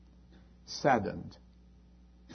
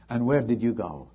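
normal talking voice

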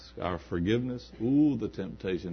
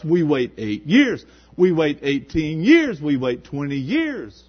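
loud, slow, each year hammered; preacher stressing the waiting; slow emphatic preaching; 7 semitones higher, 12 dB louder, slower than usual. Acted. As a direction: pound out the years one by one.

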